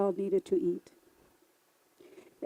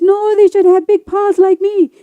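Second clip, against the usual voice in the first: much higher, loud, insistent; insistent coyote; 10 semitones higher, 13 dB louder than usual. Acted. Coyote arguing back at lizard, loud and high. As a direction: coyote digging in his heels.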